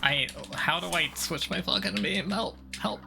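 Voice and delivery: normal talking voice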